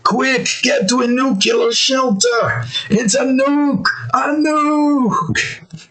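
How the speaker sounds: high, frantic Australian character voice; mock-panicked alarm, last word drawn out high; Australian guy. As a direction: imitate a cartoon Australian crying out a warning. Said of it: "I'm not doing his voice justice".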